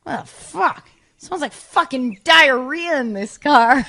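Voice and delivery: mock-angry accusation about the smell; accusatory anger. Norm in the car accusing her of the smell, angrily. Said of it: "it was just an accusatory anger".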